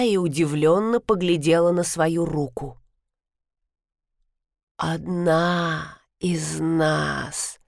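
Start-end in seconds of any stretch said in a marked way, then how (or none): 4.8-7.6 slow, soft hoarse rasp; raspy whisper. A narrator's reading. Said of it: "прохрипела она".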